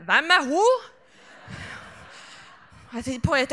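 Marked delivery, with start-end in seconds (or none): 0.1-0.9 raised, loud question from the crowd; imitated heckler's call. Audience member on the back row to the stage, mock shout. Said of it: "hørte noen på bakerste rad rope".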